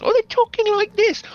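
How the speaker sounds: piping, extremely high actor imitation; Masterpiece Theater voice. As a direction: put on a TV drama voice at the top of the range. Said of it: "everyone's talking like this".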